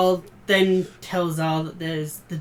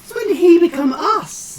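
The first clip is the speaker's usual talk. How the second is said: incredulous; 10 semitones higher, 8 dB louder than usual. Real, not acted. Incredulous banter reply.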